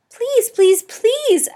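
squeaking please; squeaky pleading. A narrator's reading. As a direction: beg in a tiny squeaky hamster voice. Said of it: "I squeaked".

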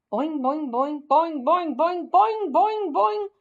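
rhythmic frog noise, rising in pitch; bouncing frog call. A narrator's reading. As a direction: build each boing like bigger hops. Imitates frog hopping noises, building.

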